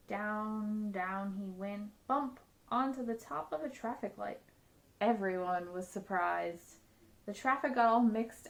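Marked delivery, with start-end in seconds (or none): none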